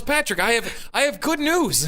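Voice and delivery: high chirpy mock client; imitating Jose. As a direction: put on the cheerful caller voice. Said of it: "He goes".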